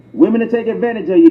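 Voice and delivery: ordinary talk, nothing marked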